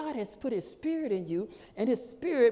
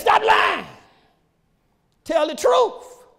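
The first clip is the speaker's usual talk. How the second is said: high, loud scriptural command; preaching shout; 12 semitones higher, 8 dB louder, slower than usual. Real, not acted. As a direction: shout it out to the congregation.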